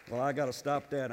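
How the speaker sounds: ordinary talk, nothing marked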